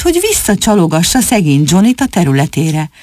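ordinary delivery

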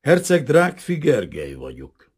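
high, loud, slow formal announcement; measured introduction. A narrator's reading. Prince introduces himself to the intruder, measured and formal. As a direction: state the name in deliberate, drawn-out syllables. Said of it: "úgy mondta kimérten".

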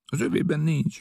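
ordinary delivery, nothing marked

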